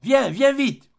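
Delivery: high, slow, loud call across the garden; crying out. A narrator's reading. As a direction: call her name out loud and long. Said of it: "cria-t-il".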